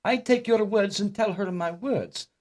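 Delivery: halting, high mock Dutch accent; caricatured Dutch visitor, pitched high; mock Amsterdam accent. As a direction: explain slowly as an imitated man from Amsterdam. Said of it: "I'm from Amsterdam".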